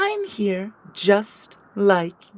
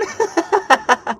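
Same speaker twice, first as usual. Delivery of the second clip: villain laugh; 9 semitones higher than usual. Acted.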